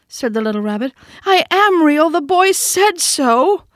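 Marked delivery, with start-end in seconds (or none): 1.2-3.6 much higher, louder and slow tearful protest; near tears. A narrator's reading. Toy rabbit insisting he is real to the wild rabbit, near tears. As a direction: fight back tears and insist. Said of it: "And he nearly began to cry".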